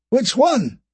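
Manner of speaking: high, sharp demand. Acted. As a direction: insist on an answer now. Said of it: "Poe demanded".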